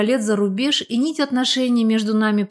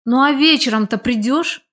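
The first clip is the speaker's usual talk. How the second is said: louder, higher eager question; hopeful; 4 semitones higher, 5 dB louder than usual. A narrator's reading. Man inviting a friend to his party, hopeful. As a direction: ask brightly, hoping for a yes. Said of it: "с надеждой в глазах проговорил Виталька".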